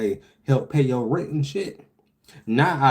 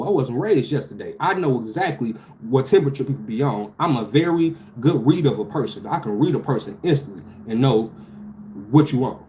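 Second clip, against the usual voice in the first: usual speaking voice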